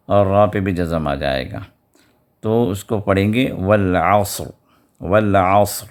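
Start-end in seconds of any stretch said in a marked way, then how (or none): 3.5-5.9 والعصر with thick ص and heavy stopped ر; والعصر ending on a full, heavy ر; heavy sad and ra. Shown as a demonstration. Teacher demonstrating the pausal form of والعصر. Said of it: "صاد کا sound بھی آئے".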